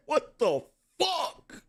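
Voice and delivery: imitating her. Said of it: "The way she said".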